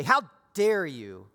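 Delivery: mock outrage, hammed-up; a hint of a scolding God; mock shaming. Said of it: "shame her and say".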